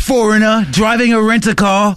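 high-pitched mock British tourist; British accent. Mocking the foreign driver's excuse, British accent. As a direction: put on a hapless Brit's accent. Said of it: "Just like that".

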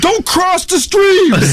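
very high, drawn-out mock shout; ranting. Man halfway through a rant to friend, hammed-up shouting. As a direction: yell a long, high-pitched warning. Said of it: "halfway through the rant".

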